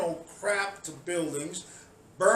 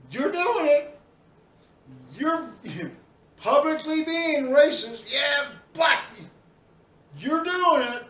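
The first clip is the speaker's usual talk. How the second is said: high, loud shouted accusation with a laugh; furious rant; 8 semitones higher, 7 dB louder than usual. Real, not acted. Ranting at protesters, shouting high and loud. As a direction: shout it at them in disbelief.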